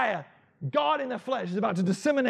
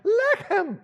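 "Lechem" jumps far higher; voice going up; 12 semitones higher, 3 dB louder than usual. Acted. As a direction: let your voice fly up on "Lechem". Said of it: "his voice went up like that".

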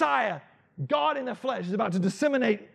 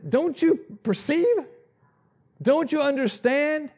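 "Don't you perceive? Don't you understand?" said higher and louder, slowly; loud rebuke; 6 semitones higher, 3 dB louder, slower than usual. Acted. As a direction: demand it sharply of the disciples. Jesus rebukes loudly.

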